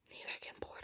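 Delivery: snide little whisper to the viewers; whispered aside. Real, not acted. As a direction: breathe it out as a sly side comment.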